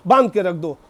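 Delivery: normal talking voice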